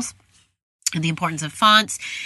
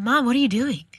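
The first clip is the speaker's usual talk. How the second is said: imitating young son; 6 semitones higher than usual. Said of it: "my seven-year-old ... said".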